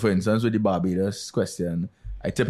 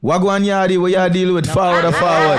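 loud, high Jamaican patois; Jamaican accent; 8 semitones higher, 7 dB louder than usual. Shown as a demonstration. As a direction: shout it like a Jamaican yardie. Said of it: "can I hear your Jamaican?".

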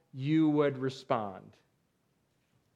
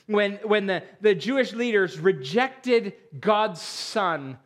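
preaching; 3 semitones higher, 4 dB louder, slower than usual. Acted. Preaching climax on God's plan.

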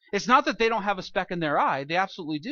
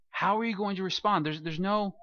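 usual speaking voice

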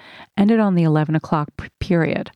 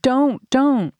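screaming plea; 6 semitones higher than usual. A narrator's reading. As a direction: beg him to stop, in pain. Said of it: "I screamed".

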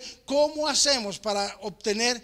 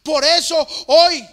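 rising, louder preacher's cadence; fervent preaching; 4 semitones higher, 6 dB louder than usual. Acted. Preacher urging believers that they are different, fervent and loud. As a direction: drive it home to the congregation.

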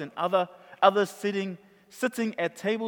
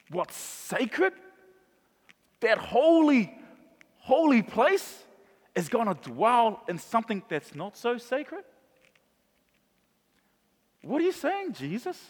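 higher, bewildered; incredulous listener; 3 semitones higher than usual. Acted. Congregant bewildered by Jesus's words, incredulous. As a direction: ask it in disbelief, pitching up. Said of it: "Wait, wait, wait".

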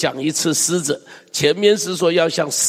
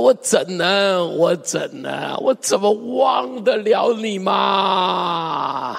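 drawn-out cry of longing; slow, swelling wail on the last question; anguished lament; 3 semitones higher, slower than usual. Acted. Preacher voices a love that cannot forget, wailing lament. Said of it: "这不就是何西阿的叹息吗".

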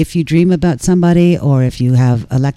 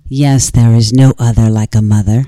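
low haunting voice; 5 semitones lower, 4 dB louder than usual. Pretend. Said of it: "I'm hoping it comes in ghost voice".

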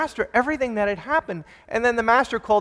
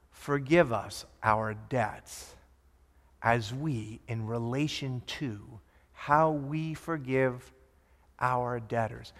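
prayer; 6 semitones lower, 8 dB quieter, much slower than usual. Acted. Quoting the prayer slowly.

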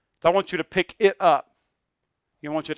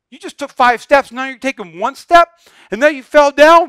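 a touch of mock annoyance, raised voice; mock mad dad; 5 semitones higher, 6 dB louder than usual. Imagined father to fallen baby, mock scolding. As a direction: pretend to scold the toddler. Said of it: "We didn't get mad at him".

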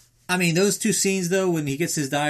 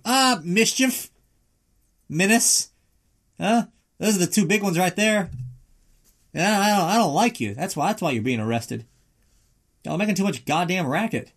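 officer lists the rider's charges, lightly voiced and grumpy; mock grumpy cop; 7 semitones higher than usual. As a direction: scold like a petty cop.

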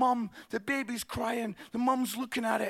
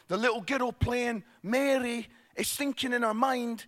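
ordinary talk, nothing marked

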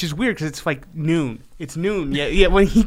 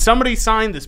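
higher, louder mock demand; mock exasperated plea; 8 semitones higher, 6 dB louder, faster than usual. Grimace begs for a signature, mock exasperated. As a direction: call it out in pretend frustration. Translating Grimace's frustrated noise.

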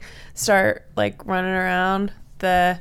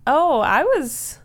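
high chirpy voice; 6 semitones higher, 3 dB louder than usual. Acted. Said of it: "the ether is like".

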